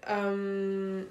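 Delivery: usual speaking voice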